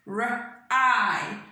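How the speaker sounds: "K-R-I" as separate k, r, eye sounds; sounded out. Shown as a demonstration. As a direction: sound out cry piece by piece. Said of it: "Then next is cry".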